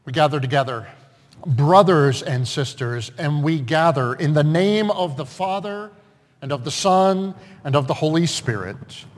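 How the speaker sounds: measured, projected church opening; liturgical invocation. Real, not acted. Pastor opening worship with brothers and sisters, liturgical invocation. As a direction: proclaim it solemnly to the congregation.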